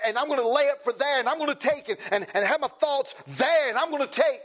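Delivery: high, loud preacher's cry; preaching at full force. Acted. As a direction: preach it hard to the congregation.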